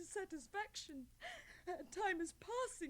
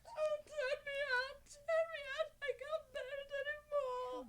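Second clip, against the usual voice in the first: high, loud sobbing plea; 8 semitones higher, 5 dB louder than usual. Acted. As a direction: beg through tears. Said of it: "Don't cry, child".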